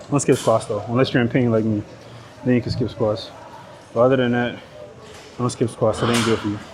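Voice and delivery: normal talking voice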